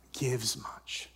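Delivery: unvoiced whisper, slow; hushed close. Real, not acted. Pastor whispering the closing point to the church. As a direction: let the last words fall to a whisper.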